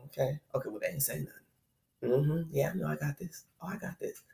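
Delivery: a mom imitating her daughter's quiet sass; kid with attitude. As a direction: brush it off like a smug little kid. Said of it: "she got a little attitude".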